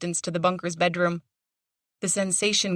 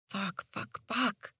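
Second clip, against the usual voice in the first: much softer held-in swears; muttered swears under the breath; swearing under breath; 3 semitones higher, 10 dB quieter, much slower than usual. A narrator's reading. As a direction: bite back the swearing. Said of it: "hold the swears inside".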